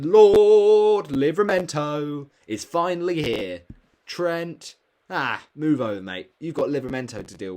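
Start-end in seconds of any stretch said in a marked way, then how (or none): 0.0-3.6 loud, slow herald voice; grand announcement. Acted. Hailing his favourite defender to viewers, as a slow, loud mock herald. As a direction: announce him like royalty arriving.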